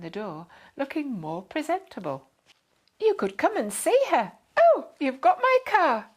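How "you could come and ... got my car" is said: high and loud, bursting out in surprise; sudden declaration. A narrator's reading. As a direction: blurt it out on seeing the car. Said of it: "she suddenly declared".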